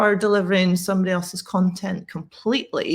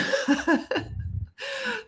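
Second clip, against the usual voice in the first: high burst of disbelieving laughter; real laugh; 5 dB quieter than usual. Real, not acted. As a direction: laugh out at the absurd remark. Laughing at overheard remark.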